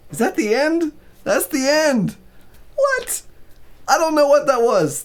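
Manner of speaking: high, loud incredulous exclaims; real shock at the ending; disbelieving. Real, not acted. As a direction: react stunned at the ending. Reader shocked by abrupt ending.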